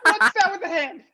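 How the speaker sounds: high-pitched, loud alarm; alarmed protest. Acted. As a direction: squeal it in shocked protest. Dean protests the hand gesture.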